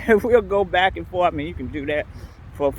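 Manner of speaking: ordinary delivery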